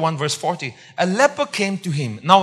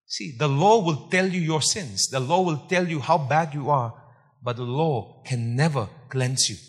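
ordinary talk, nothing marked

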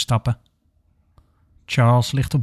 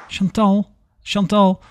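higher, urgent call of a name; lightly acted nurse calling a colleague; 7 semitones higher, slower than usual. A narrator's reading. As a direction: call out for her attention, raised pitch. Nurse calls out, running up.

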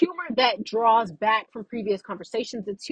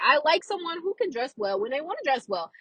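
usual speaking voice

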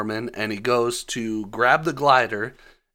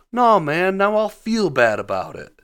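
higher, louder whiny objection; hammed-up reluctant refusal, higher and louder; mock protest; 9 semitones higher, 5 dB louder than usual. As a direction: protest like a guilt-ridden wizard. Doctor Strange voiced in a recap.

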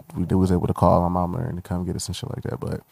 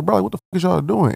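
higher-pitched mock yell at the cops; retold angry outburst; 11 semitones higher than usual. Teen recalling yelling at cops searching sister, retold anger. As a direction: replay the outrage, pitched up. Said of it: "So I'm sitting here pissed".

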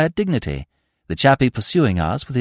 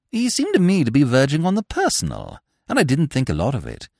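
usual speaking voice

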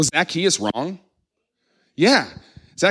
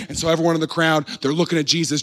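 normal talking voice

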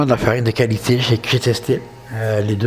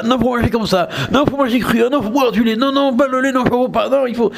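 high-pitched mock arguing; mock debaters; 8 semitones higher than usual. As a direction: parody insistent objectors, pitched well up throughout. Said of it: "j'ai pas envie de débat".

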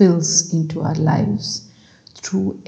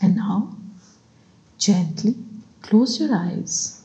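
calm, spaced-out phrases, each left to settle; guided meditation; 3 dB quieter than usual. Acted. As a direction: guide them gently through each breath, unhurried.

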